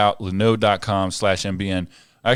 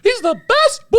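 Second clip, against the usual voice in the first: Mickey Mouse voice; 3 dB louder than usual. Retold or imitated. Praising the dog in a very high Mickey Mouse voice. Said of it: "sound like Mickey Mouse".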